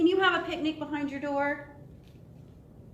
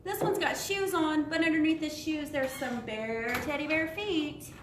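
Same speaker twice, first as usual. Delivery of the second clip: ordinary delivery, nothing marked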